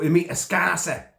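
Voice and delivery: "Scouser" slipping into a Cockney accent; accidental Cockney accent. Real, not acted. Reviewer introduces a new character, accidental Cockney accent. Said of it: "I said Scouser with a Cockney accent".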